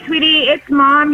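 normal talking voice

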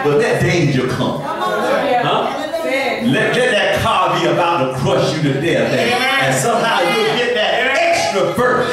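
high, loud sermon whoop; fiery preacher lifting the church; preaching at full force. Real, not acted. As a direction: push it up into a preacher's chant.

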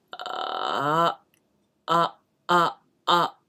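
low fry turning to voice; fry building into a tone; vocal fry. Shown as a demonstration. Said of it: "これがだんだん強くなって".

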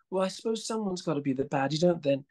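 a touch of a shopper trying to make amends; apologetic older woman. Retold or imitated. Actor imitating the shopper feeling bad, lightly voiced apologetic older woman. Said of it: "and then she said".

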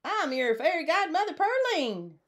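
very high-pitched possum fairy godmother; high, excited character voice; grand announcement. A narrator's reading. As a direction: announce yourself in a high, showy squeal. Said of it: "and she announced".

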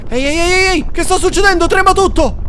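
high, loud alarmed cries; startled panic. Real, not acted. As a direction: yell in sudden alarm. Terremoto improvviso. (grida allarmate e acute)

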